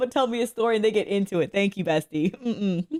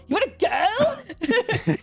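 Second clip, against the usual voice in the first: much higher and slow, hammed-up taunt; squeaky mock challenge; 12 semitones higher, much slower than usual. Streamer squaring up to a stranger, hammed-up high taunt. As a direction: stretch it out high like a cartoon punk. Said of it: "he would start being like".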